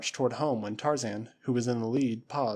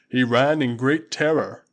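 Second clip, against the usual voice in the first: loud, slow, scornful warrior; booming Waziri warrior, slowed; 8 dB louder, slower than usual. Acted. Usula mocking the impostor to Tarzan, loud and slow. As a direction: drag it out with relish.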